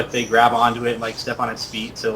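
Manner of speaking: ordinary talk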